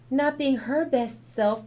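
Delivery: ordinary delivery, nothing marked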